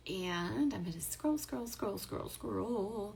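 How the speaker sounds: soft murmur while scrolling; muttering to herself. Real, not acted. Host murmuring to herself while scrolling comments, soft murmur. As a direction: quietly mutter the words as you scroll.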